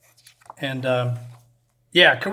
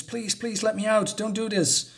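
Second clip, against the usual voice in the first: mock panicked pleading; 6 semitones higher than usual. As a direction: plead in a mild, hushed panic. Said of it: "[name] is, is panicking".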